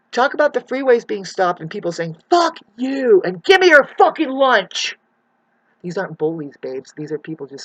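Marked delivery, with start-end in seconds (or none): 2.3-5.0 imitated angry yells. Imitating angry people on freeway.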